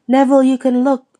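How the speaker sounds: loud, quick call across the stands. Acted. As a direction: call out brightly to a friend. Ron calls to hiding Neville.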